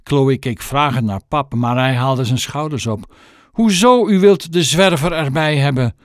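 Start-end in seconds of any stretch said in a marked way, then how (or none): 3.5-6.0 high, loud, stunned question; mother stunned that the tramp must come; dumbfounded. Acted. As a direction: gasp it in disbelief. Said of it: "vroeg moeder stom verbaasd".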